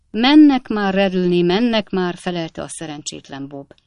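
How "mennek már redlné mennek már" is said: loud, harried assurance; calling up to placate. A narrator's reading. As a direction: call upstairs to hold off the landlady. Lodger calls up to landlady.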